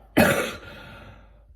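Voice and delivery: deep rattling cough from the chest; chesty cough. Real, not acted. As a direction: cough hard, clearing the chest. Coughing fit interrupts Bible reading.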